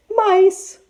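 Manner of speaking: a very high, loud quick squeak; quick high answer. Acted. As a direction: blurt it out high to cover up. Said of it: "Auntie Tiger said quickly".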